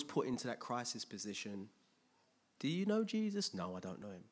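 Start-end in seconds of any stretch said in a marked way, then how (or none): 2.6-3.5 high-pitched probing question; questioning accuser. Acted. Bystander quizzing Peter about Jesus, high inquisitive voice. 3.5-4.2 low, soft brush-off; muted denial. Acted. Peter dodging a bystander's question, low quiet denial.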